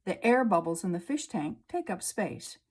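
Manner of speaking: ordinary talk, nothing marked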